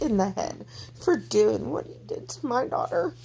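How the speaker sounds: high, upset voice straining through tears; choked-up, fierce and protective, pitched high; tearful anger. Real, not acted. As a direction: pour out real hurt and fury. Tearful rage for the child.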